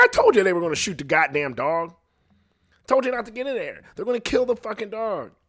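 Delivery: I-told-you-so rant; heated son yelling back at his mom; exasperated told-you-so. Retold or imitated. Son scolding mom after shooting.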